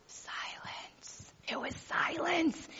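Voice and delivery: hushed breathy whisper, slowed; whispered silence. Acted. Describing the husband's silence at the store, whispered. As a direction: let the word hang in a whisper.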